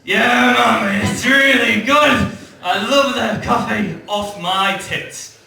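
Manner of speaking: loud, slow, over-caffeinated shout; wired shout. Retold or imitated. Wired from strong coffee, shouting back at his mum. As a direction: bellow it like you're buzzing on rocket fuel. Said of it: "I was like".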